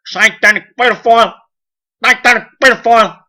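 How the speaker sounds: high, loud mob shriek; mock hysterical shouting. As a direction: shout it like a frenzied witch hunt. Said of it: "in a witch hunting state going".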